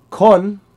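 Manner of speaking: "Kon" in a level middle tone. Shown as a demonstration. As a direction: hold "Kon" level and loud. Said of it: "which is middle tone".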